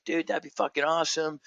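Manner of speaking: eager dude on the phone; excited acceptance. Retold or imitated. Friend accepting a podcast invite, excited.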